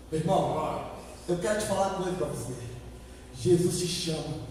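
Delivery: low, soft word to the listener; hushed appeal. Real, not acted. Preacher telling a brother that Jesus calls, hushed. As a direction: confide it quietly to one person.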